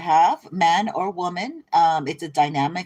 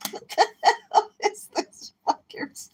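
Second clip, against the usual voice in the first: breathy high whisper through laughter; whispering while cracking up; laughing whisper. Real, not acted. Reader swears at the cards while giggling, whispered.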